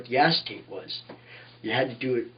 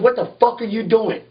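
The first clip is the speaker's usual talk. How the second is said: higher, louder angry demand; angry boss; 8 semitones higher, 3 dB louder than usual. Retold or imitated. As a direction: bark it at the kid caught out. Boss George repeats the demand.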